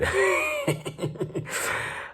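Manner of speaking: long high giggle; high laugh. Real, not acted. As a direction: giggle at your own cheekiness. Laughing at own cheeky remark.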